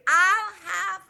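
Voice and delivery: high-pitched imitation of a deaf mother; mother ordering. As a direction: repeat mother's mispronounced order in her high voice. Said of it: "in full voice".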